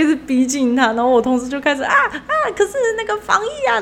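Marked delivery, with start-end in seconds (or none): 1.9-3.8 much higher, laughing, mock panicked yelp. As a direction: play the cornered coworker squealing through laughter. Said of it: "就慌张了". (模仿同事慌张，音调很高，边笑边喊)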